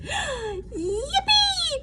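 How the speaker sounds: high squeal of joy; excited yippee. A narrator's reading. Froggy thrilled about the shelter, excited squeal. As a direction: burst out with delight. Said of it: "called Froggy".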